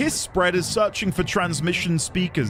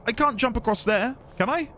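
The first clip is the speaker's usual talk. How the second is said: ordinary talk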